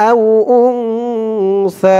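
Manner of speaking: আও উনসা drawn out slowly, the নুন hidden in a nasal hum before সা; slow nasal ikhfa on উনসা; ikhfa ghunna demo. Shown as a demonstration. Teacher demonstrating ikhfa ghunna in a Quran recitation lesson. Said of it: "এখানে ইকফা গুন্না".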